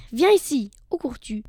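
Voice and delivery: loud, sudden wolf shout; short burst of wolfish anger; angry yell. Acted. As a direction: yell after escaping prey. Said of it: "hurla-t-il en essayant d'arrêter sa proie".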